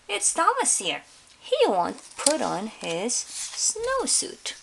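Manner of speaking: high, then slow complaint; complaining teacher. Acted. Teacher complaining to the principal about Thomas, high and slow. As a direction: whine it to the principal.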